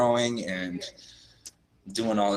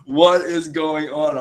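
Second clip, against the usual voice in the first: laughing disbelief; 3 semitones higher, 4 dB louder than usual. Retold or imitated. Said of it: "I was, I was like".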